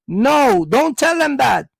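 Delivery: normal talking voice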